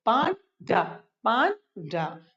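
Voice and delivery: "पांडा" broken into pan, pause, da; split syllables. Shown as a demonstration. Teacher drilling two-syllable words, "पांडा" split as pan - da. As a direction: say each "पांडा" in two separate beats. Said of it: "दो syllable से बने हैं".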